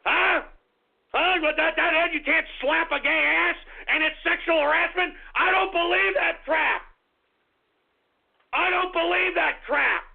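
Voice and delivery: high, indignant shouting; outraged rant. Real, not acted. Host ranting to listeners, outraged high-pitched yelling. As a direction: yell it in disbelief.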